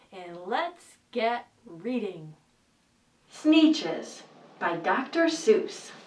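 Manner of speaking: ordinary delivery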